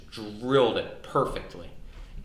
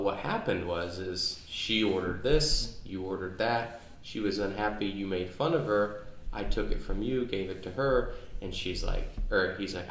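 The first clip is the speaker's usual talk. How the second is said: ordinary talk, nothing marked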